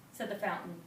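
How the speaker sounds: plain reading voice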